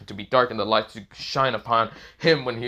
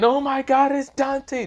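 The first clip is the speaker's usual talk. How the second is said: very high, loud mock squeal; fangirl scream; 5 dB louder, slower than usual. A narrator's reading. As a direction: scream like a crowd of excited fangirls. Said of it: "every girl kinda just screaming out".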